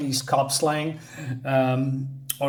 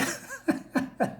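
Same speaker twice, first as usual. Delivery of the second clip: laugh; 8 dB quieter than usual. Real, not acted. After reading the taunt.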